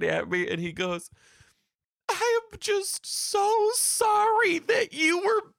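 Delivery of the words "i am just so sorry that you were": high, slow, wailing imitation of a sobbing kid; bawling child. Samuel apologizing to his teacher, mock bawling. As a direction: wail it slowly until the words give out.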